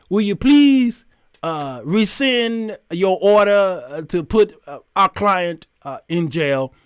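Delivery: slow, halting grand address; mock-formal plea. Woman asking the judge to rescind, slow and mock-formal. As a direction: plead ponderously, padding it with pauses.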